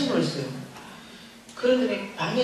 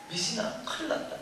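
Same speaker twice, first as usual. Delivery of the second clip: hushed urgent; 8 dB quieter than usual. Retold or imitated. Sister on phone, bad news.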